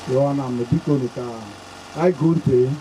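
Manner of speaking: normal talking voice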